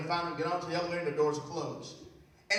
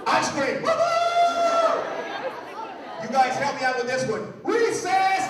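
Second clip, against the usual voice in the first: much higher and louder, squealing woo-hoo; excited teacher; 14 semitones higher, 7 dB louder, much slower than usual. Retold or imitated. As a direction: hype up a room of little kids. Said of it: "The teacher said something like this".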